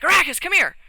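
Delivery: high, loud summons; acted-out holler across the room; calling out. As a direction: call a companion over. Acting out calling a companion over.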